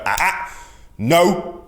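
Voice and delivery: sharp, high, loud ah-ah then no to a dog; stern ah ah no. Shown as a demonstration. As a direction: snap the correction at a barking dog. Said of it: "stern, deep, good vocal inflection".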